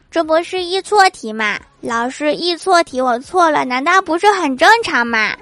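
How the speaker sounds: put-on high-pitched, insistent kid's protest; protesting pupil. Pupil defending a wrong answer to teacher, high mock-indignant protest. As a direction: argue back as an indignant schoolkid.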